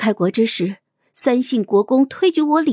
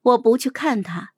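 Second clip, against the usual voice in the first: usual speaking voice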